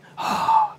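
high, dramatic put-upon sigh; hammed-up huff of the offended guest; mock exasperated sigh. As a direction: sigh like a wronged customer. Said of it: "And he was going".

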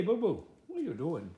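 ordinary talk

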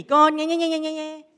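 put-on high, childish whine aimed at God; whining child. Owning up to nagging God, whining child. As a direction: imitate your own nagging like a pestering kid. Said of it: "And that was me".

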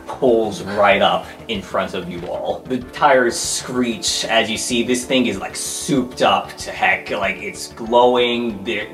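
ordinary talk

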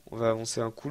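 ordinary talk

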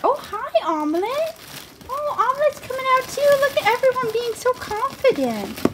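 high cooing pet voice. Real, not acted. Owner cooing to her pet rats, high and sweet.

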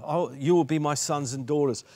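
normal talking voice

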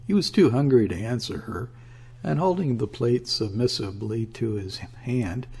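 usual speaking voice

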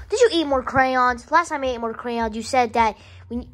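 ordinary talk, nothing marked

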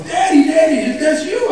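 put-on high, bright little boy voice, calling out; excited child. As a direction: squeal it like a thrilled five-year-old. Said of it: "my son goes".

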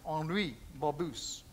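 Henri Babus said slowly with a light French accent, ahn-REE ba-BOO. Shown as a demonstration. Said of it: "let's try and say it a little bit with a French accent".

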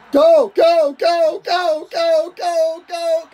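high, loud urging go go go; excited shout. Real, not acted. Gamer urging his runner toward the end zone, shouting. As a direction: push the runner on with every go. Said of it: "I'm getting too excited".

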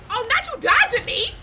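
high, loud mock-offended cry; indignant outburst. Real, not acted. Player scolding the enemy for dodging, indignant outburst. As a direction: yell it in shocked indignation.

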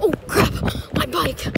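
sudden panicked cry; shocked yelp over the bike; alarmed outburst. Real, not acted. Bike about to be taken.

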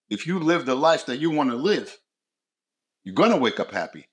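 usual speaking voice

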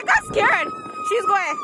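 very high squealing call; excited squeal. Real, not acted. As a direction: squeal as she starts sliding. Watching friend slide.

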